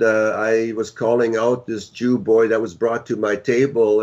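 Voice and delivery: ordinary talk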